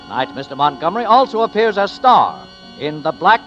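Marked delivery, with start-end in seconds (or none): none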